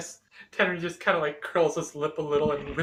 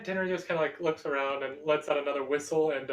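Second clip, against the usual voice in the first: ordinary talk, nothing marked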